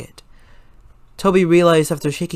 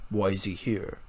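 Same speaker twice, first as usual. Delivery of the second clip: low tired lecturer; stern low voice; 6 semitones lower, 9 dB quieter than usual. A narrator's reading. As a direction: lower and softer, weary and firm.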